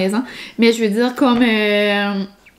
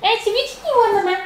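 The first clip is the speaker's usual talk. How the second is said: doggy mom voice; 10 semitones higher, faster than usual. Real, not acted. Calling her dog onto her lap, high coaxing voice. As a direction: coax the puppy up in a high sing-song.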